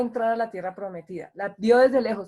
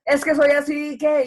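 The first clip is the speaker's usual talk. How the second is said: loud mock excuse; 4 semitones higher, 6 dB louder than usual. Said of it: "y hablan así fuerte".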